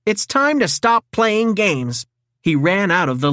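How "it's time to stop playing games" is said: determined. A narrator's reading. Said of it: "Donatello said".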